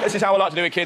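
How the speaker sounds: usual speaking voice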